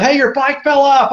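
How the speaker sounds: high-pitched imitated yell; retold yelling. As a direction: call it out like a passing driver.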